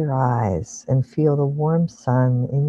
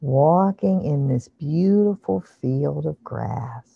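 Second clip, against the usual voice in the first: guided meditation. Acted. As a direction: ease them into calm imagery. Leads a guided visualization.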